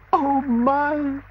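high, breathless gasp. Acted. Toad swept away in the river gasps. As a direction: gasp in panic. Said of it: "gasped poor Toad".

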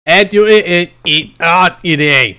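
high, loud garbled stammer; loud, high nonsense babble; loud mumbling. Acted. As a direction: mumble nonsense loudly on purpose. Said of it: "I was speaking mumbling".

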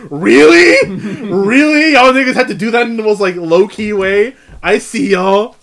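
loud, high, amused disbelief; mock incredulous. Retelling his reaction to the Arrow episode, loud mock disbelief. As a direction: call the writers out, laughing. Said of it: "And I was like".

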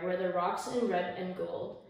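ordinary delivery, nothing marked

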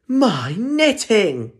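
high, slow, loud wail; cried out. Acted. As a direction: wail over the lost knitting. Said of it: "cried Mum".

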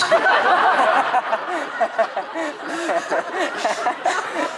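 squealing laugh up high; helpless high laugh; high burst of laughter. Real, not acted. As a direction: break into a high-pitched laugh. Reacting to granddad's line.

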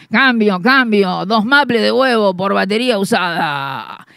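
low, loud hawker's bellow; vendor call. Retold or imitated. Rival hawker bellowing his offer, low and loud. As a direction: boom out the trade offer. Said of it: "escucho de nuevo".

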